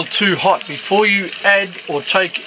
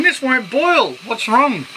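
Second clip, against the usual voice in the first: higher-pitched imitation of a complaint; puzzled, raised voice quoting someone else; quoting his puzzled partner; 8 semitones higher, slower than usual. Man retelling his partner's first frying attempt, quoting puzzlement.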